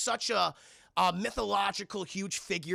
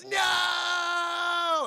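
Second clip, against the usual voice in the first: high, loud shout; mock wailing no, much higher; kid yelling no; 11 semitones higher, 4 dB louder than usual. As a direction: scream it falling down the slide. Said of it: "Ralphie goes".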